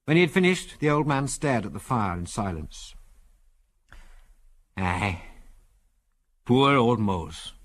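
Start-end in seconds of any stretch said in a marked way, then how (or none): 4.8-7.5 slow, mournful Scots voice; old Scotsman's slow, Scots-accented regret. A narrator's reading. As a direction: lament slowly in a Scots accent. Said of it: "in a strong Scots accent".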